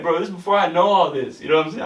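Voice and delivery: ordinary talk, nothing marked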